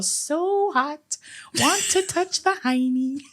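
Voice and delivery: high, goofy movie-character imitation; Billy Madison. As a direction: imitate the goof in a high, drawn-out voice. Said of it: "where he's all".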